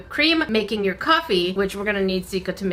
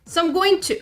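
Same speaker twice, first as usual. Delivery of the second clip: normal talking voice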